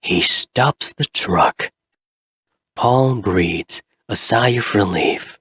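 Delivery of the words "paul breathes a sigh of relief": ordinary delivery, nothing marked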